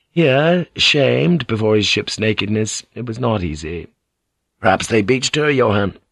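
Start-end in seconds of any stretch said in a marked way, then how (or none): none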